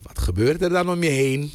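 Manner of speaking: ordinary talk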